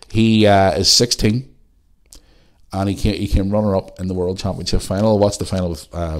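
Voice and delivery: normal talking voice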